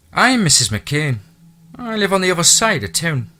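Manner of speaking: high, loud Irish housekeeper voice; chatty Irish brogue, pitched high. Acted. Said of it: "thick Irish brogue".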